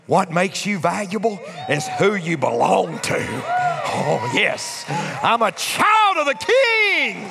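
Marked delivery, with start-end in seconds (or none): 3.2-7.3 exultant preaching shout. Real, not acted. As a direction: proclaim it with full joy. Preacher exulting, child of the King.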